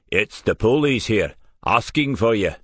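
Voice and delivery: Glaswegian stress on first syllable of police; Glasgow police. Acted. Billy telling Joe police have come, Glasgow accent. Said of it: "with heavy emphasis on the first syllable".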